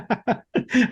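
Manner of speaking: a bright high burst of laughter; short amused laugh. Real, not acted. Host laughs at diplomacy remark.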